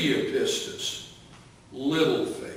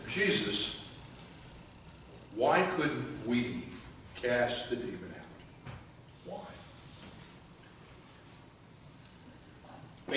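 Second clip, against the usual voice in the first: softer, lower, hushed asking; private question; 3 semitones lower, 12 dB quieter than usual. Retold or imitated. Disciples asking Jesus privately, in a hushed lower voice. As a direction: ask it quietly so the crowd can't hear. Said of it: "came to him privately and said".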